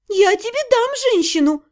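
high indignant "дам" punched. A narrator's reading. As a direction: bristle at being called "женщину". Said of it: "возмутилась я".

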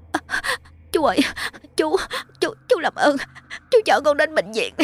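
high, shaking voice begging for help; trembling plea. A narrator's reading. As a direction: stammer out the plea through the pain. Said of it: "cô ta run rẩy nói". (giọng run rẩy van nài)

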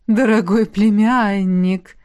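медленно, лучась довольством; слегка сыгранная сияющая любезность дяди; сияющий дядюшка. Acted. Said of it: "просиял безопасник". (дядя сияя приветствует племянника)

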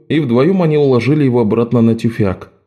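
usual speaking voice

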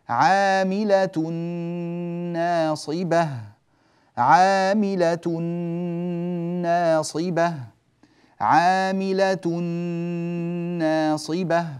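عاملة ناصبة chanted slowly, nasal hum held on the tanween into the nun; recited example. Shown as a demonstration. Teacher reciting the example twice for students. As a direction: draw out عاملة, then ناصبة with ghunna. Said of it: "ومثال آخر".

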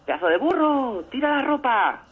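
high called-out yell; calling up. Retold or imitated. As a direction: shout it up to the window. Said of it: "empieza por la ventana".

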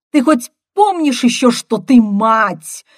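high, loud bark, slowing at the end; last roar. A narrator's reading. Brother reminding sister she is a mother, roaring. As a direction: bellow it, stretching the final words. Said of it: "гаркнул я в последний раз".

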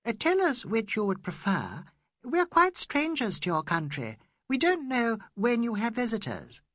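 eager polite pleading. A narrator's reading. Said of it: "said Miss Quested".

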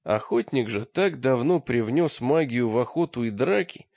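plain reading voice

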